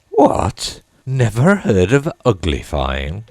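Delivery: huge high shocked What; exclaimed in surprise. A narrator's reading. Gryphon exclaiming at Alice's ignorance, loud and astonished. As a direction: shoot the pitch way up in astonishment. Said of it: "it exclaimed".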